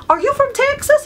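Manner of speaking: very high-pitched nosy question; imitated curious stranger. Stranger asking whether she is from Texas, mock eager curiosity. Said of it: "And they're like".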